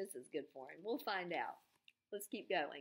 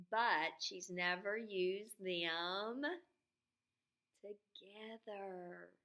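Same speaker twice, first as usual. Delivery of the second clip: slow emphasis; much slower than usual. Shown as a demonstration. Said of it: "teacher stresses key point".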